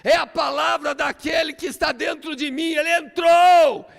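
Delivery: preacher shouting his climax over the music; preaching at full force. Real, not acted. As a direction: preach it to the rafters.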